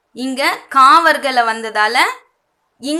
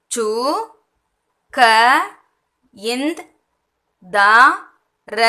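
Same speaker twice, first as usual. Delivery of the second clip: "சு, க, இந்த், த, ர" each said alone; spelling the word out by ear for learners; sound by sound; much slower than usual. Shown as a demonstration. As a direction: leave a long pause after each sound. Teacher splits word into sounds.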